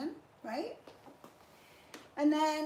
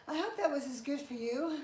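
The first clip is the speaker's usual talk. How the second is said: usual speaking voice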